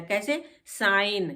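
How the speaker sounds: normal talking voice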